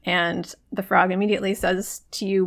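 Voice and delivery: ordinary talk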